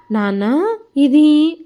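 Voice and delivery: faltering, then a high loud jolt on the question; stammering shock. A narrator's reading. Son seeing the deed papers, stammering in shock. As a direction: stumble over the words, stunned. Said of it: "అంటూ తడబడుతూ అడిగాడు".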